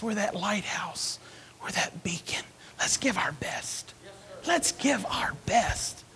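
soft, slow whispered vow. Acted. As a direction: whisper it like a quiet promise. Hushed imagined declaration.

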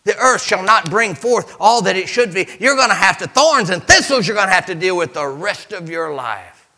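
loud, high preaching cadence; fiery pulpit preaching; preaching at full force. Acted. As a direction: drive the story home to the congregation. Preaching the garden story.

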